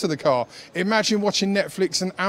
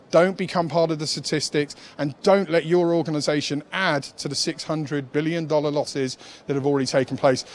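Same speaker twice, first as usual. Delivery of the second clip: usual speaking voice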